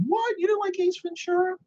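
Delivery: shocked high-pitched disbelief; mock outrage, voice shooting way up; incredulous. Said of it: "a lot of people are like".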